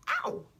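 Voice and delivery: sudden high ow; a sharp cry at a paper cut; real yelp of pain. Real, not acted. Reader cuts her finger on the book, sharp yelp. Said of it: "I just cut myself on the book".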